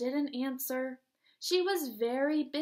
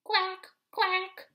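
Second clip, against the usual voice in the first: loud high quacking duck; 6 semitones higher, 7 dB louder than usual. Acted. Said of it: "called the duck".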